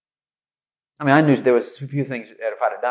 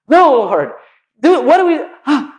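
frantic Moses; 11 semitones higher, 7 dB louder than usual. Retold or imitated. Moses panicking, pleading with God.

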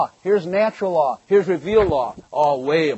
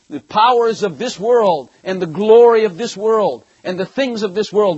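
much higher, louder fiery preaching; preaching at full force; 6 semitones higher, 6 dB louder than usual. Acted. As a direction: thunder the rebuke at the congregation. Preaching climax.

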